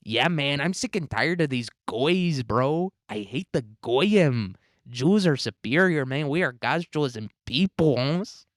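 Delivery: lilting mock Mexican accent, swooping up and down; caricatured sing-song Mexican accent. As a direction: do a hammed-up Mexican accent, lifting the last word. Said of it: "Sheinbaum says".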